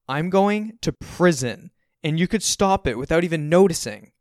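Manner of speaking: high, loud, emphatic accusation; frustrated protest. A narrator's reading. Frustrated son protesting to his dad.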